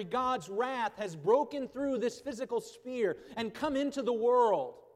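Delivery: a preacher thundering Nahum at the congregation; forceful preaching. Acted. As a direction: read God's wrath like a storm breaking. Loud reading of Nahum.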